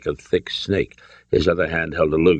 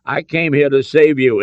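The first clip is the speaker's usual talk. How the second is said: lame; 8 semitones higher, 6 dB louder than usual. A narrator's reading. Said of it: "Indy said lamely".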